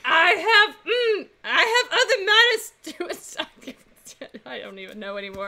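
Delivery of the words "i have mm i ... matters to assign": high squeaky mouse voice, slowed. Acted. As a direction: play a timid little mouse. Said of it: "I have to do the mouse voice".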